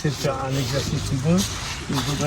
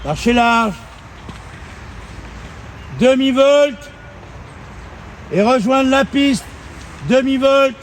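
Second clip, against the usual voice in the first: called-out commands; 6 semitones higher, slower than usual. Real, not acted. Riding coach calling figures to rider across arena, raised voice. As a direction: project each command across the ring.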